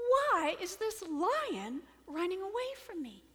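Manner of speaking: high, loud, bewildered question; puzzled lion. Acted. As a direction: wonder aloud in astonishment. Puzzled lion's acted question.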